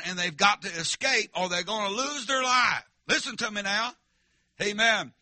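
full-force preaching. Real, not acted. Preacher warning the congregation of danger, full-force preaching. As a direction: drive the warning home to the church.

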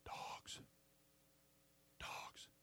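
soft, voiceless whisper of one word; whispered verdict. Retold or imitated. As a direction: hush it like a grave verdict. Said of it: "You know what the Lord would say?".

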